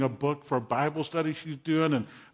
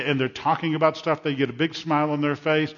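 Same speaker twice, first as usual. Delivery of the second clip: ordinary talk, nothing marked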